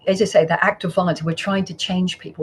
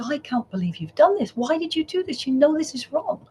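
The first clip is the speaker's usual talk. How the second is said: higher, exasperated mock telling-off; mock scolding mum; 7 semitones higher than usual. Mother to her four-year-old who bit someone, higher exasperated scolding. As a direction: play the shocked mother scolding her boy.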